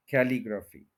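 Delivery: कैलीग्राफी as KAI-lee-graa-fee; common mispronunciation. Shown as a demonstration. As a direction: open "kae" start and long "graa" in कैलीग्राफी. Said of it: "start वाली sound खे नहीं है".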